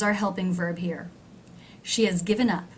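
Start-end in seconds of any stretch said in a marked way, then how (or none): 1.8-2.7 "has" said as "is", H dropped, low; reduced has. Shown as a demonstration. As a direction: swallow the H of "has". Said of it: "the word has is very low in pitch".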